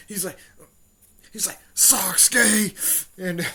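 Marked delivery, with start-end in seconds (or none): 1.7-3.1 loud, high shout of a rival's name; exasperated yell. Retold or imitated. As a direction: yell the name in mock outrage. Acts out angry call to rival.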